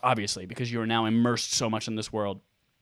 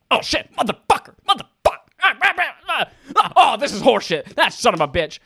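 high, loud mock rage at the game; mock gamer rage; 10 semitones higher, 5 dB louder than usual. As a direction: ham up a furious gamer losing it. Imitating raging gamer friend.

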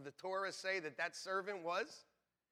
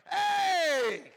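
sudden high loud yell; mock shout; 12 semitones higher, 13 dB louder than usual. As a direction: shout as if caught on camera. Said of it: "we'd be like".

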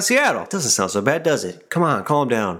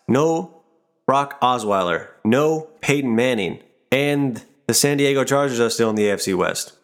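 ordinary talk

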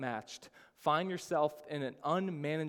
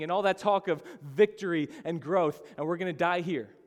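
higher, louder incredulous protest; panicked disciples; 3 semitones higher, 6 dB louder than usual. Acted. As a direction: confront Jesus in indignant panic. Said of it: "They were panicking as to why Jesus wasn't panicking".

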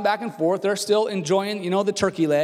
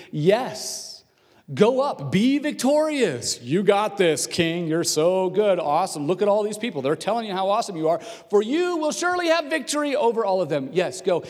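exaggerated, over-eager mock praise; dripping sarcasm; 2 semitones higher than usual. Prophet gives the king the report he wants, dripping with sarcasm. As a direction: flatter him with obvious insincerity. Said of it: "Micaiah replied sarcastically".

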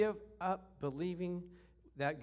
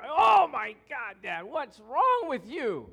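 loud, high, stretched-out mock wail; hammed-up pained protest to dad; acted agonized yell; 5 semitones higher, 10 dB louder, slower than usual. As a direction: yell it slowly like a kid being squeezed. Acts boy being squeezed by dad.

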